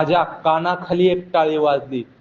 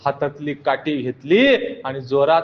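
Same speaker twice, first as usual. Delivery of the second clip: ordinary delivery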